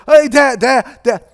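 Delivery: mock-panicked hail; acted nervous greeting. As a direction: try to head Dad off, urgently. Son hailing father running at him.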